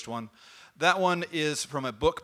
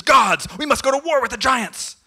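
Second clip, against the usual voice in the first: much higher, loud battle call; rallying shout; 10 semitones higher, 10 dB louder than usual. Acted. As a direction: rouse the gods to war. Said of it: "He storms back".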